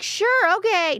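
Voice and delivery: very high, giddy giving-in; squeaky-high playful compliance; mock eager agreement. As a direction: imitate a smitten pushover agreeing. Acts out being won over.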